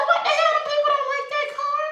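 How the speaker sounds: high-pitched incredulous retelling; amused disbelief. Retold or imitated. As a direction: squeal the question in baffled amusement. Retelling disbelief at bouncing car.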